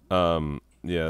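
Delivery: ordinary delivery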